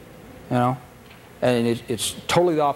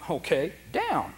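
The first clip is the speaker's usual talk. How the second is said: slow, calm command; 3 semitones higher, 5 dB quieter, slower than usual. Shown as a demonstration. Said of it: "I'm gonna say".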